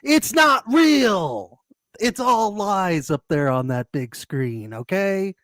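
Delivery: drawn-out high heckle, easing into slow ranting; mock yell. Boyfriend heckling the movie screen on a date, mock yelling. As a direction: stretch out the yell, then settle into slow insistence. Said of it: "stands up and yells".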